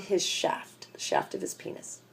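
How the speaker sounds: whispering the naughty word; hushed. Acted. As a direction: drop to a scandalized whisper. Reading a sex tip hushed.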